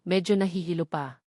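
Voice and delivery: plain reading voice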